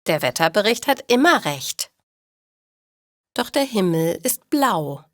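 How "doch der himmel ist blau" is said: normal talking voice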